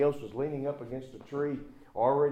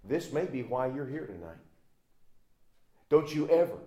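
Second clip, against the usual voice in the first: ordinary talk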